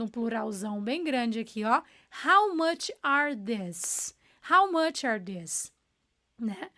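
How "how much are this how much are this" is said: "How much are this?" drawn out very slowly with a falling end; very slow model. Shown as a demonstration. Teacher pointing out the plural, very slow model. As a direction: lean on the slowness so every word lands. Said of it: "Olha o are".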